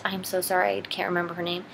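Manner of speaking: ordinary talk, nothing marked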